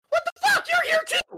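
squeaky high shout of surprise; shocked outburst. Retold or imitated. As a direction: yelp it way up high in disbelief. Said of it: "he's like".

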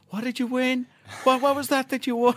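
high, teasing mock question; mocking Canadian taunt. Canadian fans seeking the fan out, high mocking taunt. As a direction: needle him with a sing-song jeer. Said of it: "and going".

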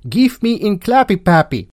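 high, comic Dutch voice; mock Dutch accent. Shown as a demonstration. Mocking Dutch as a funny language, exaggerated Dutch accent. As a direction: send up Dutch in a high-pitched voice. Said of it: "Dutch is just a funny language".

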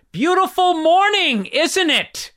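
put-on sarcastic old neighbor yelling cheerfully; drawn-out fake friendly old man call. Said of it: "Like, yelled at".

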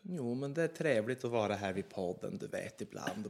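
Norrland accent. Shown as a demonstration. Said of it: "[name], hur låter norrländska?".

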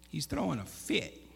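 ordinary talk

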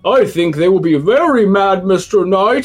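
a lightly voiced fish warning the knight. Fish telling the knight the crowd will be mad, lightly voiced. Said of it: "says Mister Fish".

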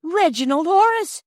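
high, loud anguished cry of a name; a mother crying out in terror; terrible cry. Acted. Barge woman crying out for her baby in terror. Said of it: "she cried in a terrible way".

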